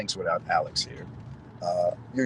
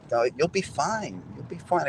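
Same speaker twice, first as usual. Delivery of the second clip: reassuring; 8 semitones higher, slower than usual. Retold or imitated. She reassures the nervous Adam.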